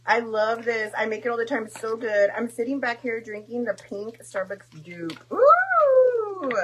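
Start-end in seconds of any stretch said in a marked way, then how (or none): 5.3-6.5 very high, loud squeal of delight; tasting a favorite drink; delighted ooh. Real, not acted. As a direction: squeal at the first sip. Sipping drink.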